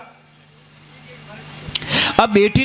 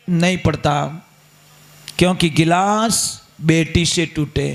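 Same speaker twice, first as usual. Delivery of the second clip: usual speaking voice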